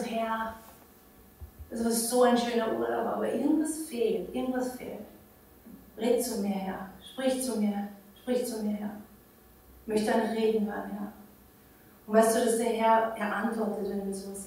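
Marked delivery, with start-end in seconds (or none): 0.0-11.1 quiet prayer. Retold or imitated. Praying on a paddleboard, retold as a soft hushed plea. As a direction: pray quietly, almost under the breath.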